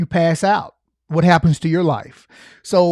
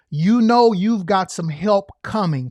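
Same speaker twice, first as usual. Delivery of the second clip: ordinary talk, nothing marked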